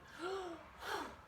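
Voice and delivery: sharp mock gasp for breath; quick showy gasp of air; demo gasp for air. Shown as a demonstration. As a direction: gasp in like a swimmer coming up for air. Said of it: "like you're getting breath".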